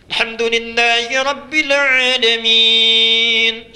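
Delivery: slow, loud, melodic chanting of the verse; imam's recitation. Retold or imitated. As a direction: lead the prayer with a showy beautiful voice. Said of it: "Imamnya bagus mimpin sholatnya".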